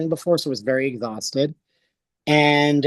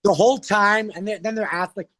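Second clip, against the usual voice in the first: usual speaking voice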